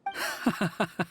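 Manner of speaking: short bitter laugh; a dry, disbelieving scoff. Acted. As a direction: laugh off the compliment with contempt. Bitter scoff at his words.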